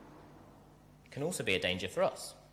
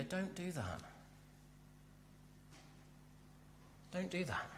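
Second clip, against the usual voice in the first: very soft, higher-pitched, hushed plea; loving plea; 5 semitones higher, 17 dB quieter than usual. Acted. Believer to a drifting loved one, hushed pleading. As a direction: beg them quietly, out of love. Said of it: "It's out of love".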